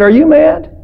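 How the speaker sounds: high, rising disbelief; incredulous. Acted. Turtle answering the scorpion's request for a ride, incredulous. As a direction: balk at an absurd request.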